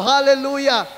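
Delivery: exultant hallelujah; joyful shout. Real, not acted. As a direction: shout it out to the congregation. Preacher celebrates.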